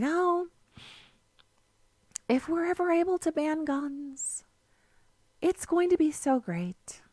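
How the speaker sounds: high, soft, dreamy, wistful sing-song; mocking dreamy liberal. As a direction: mimic a naive dreamer, sighing. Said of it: "That's, that's how they talk".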